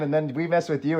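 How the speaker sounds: ordinary talk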